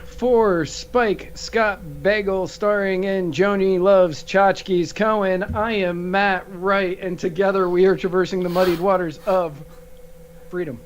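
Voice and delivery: drawn-out, booming show opener that settles down; mock announcer intro. Host welcoming listeners, drawn-out mock announcer greeting. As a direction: open the show like a grand announcer.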